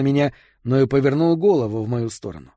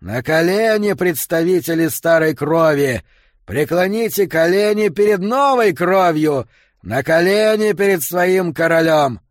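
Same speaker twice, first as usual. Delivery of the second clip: higher, louder, slow buzzing proclamation; nasty buzzing shout; 5 semitones higher, 5 dB louder than usual. Acted. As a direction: proclaim it like a sneering herald. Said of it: "крикнул Аарон своим противным жужжащим голосом". (противный жужжащий крик)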